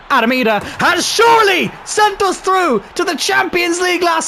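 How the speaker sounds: real joy after a late goal; ecstatic celebration. Real, not acted. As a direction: celebrate the winner with a cracking voice.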